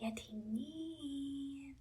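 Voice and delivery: very soft, long-drawn call; quiet voice. A narrator's reading. Princess tests if the dragon sleeps, calling quietly. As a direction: softly stretch out the name. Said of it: "الأميرة هنا قالت بصوت هادي".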